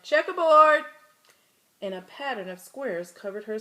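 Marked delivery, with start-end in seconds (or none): 0.0-0.8 one loud, high shouted word. Acted. A classmate shouting at striped Camilla. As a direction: yell it out across the room. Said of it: "Someone else shouted".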